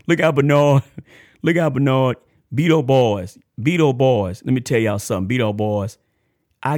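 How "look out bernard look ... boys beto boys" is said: excited shout-out. Real, not acted. Host hailing Bernard and the Beto boys, excited shout-out. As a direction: holler out a greeting with a laugh.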